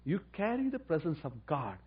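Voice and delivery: ordinary delivery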